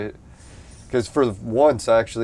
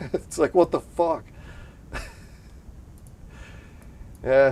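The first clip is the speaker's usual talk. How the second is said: ordinary talk, nothing marked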